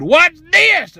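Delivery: high, loud challenge; defiant exclamation. Retold or imitated. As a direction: throw it out as a ringing dare.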